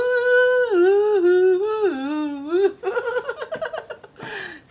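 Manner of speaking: high loud laugh; burst of laughter. Real, not acted. Laughing at own singing. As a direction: break into laughter.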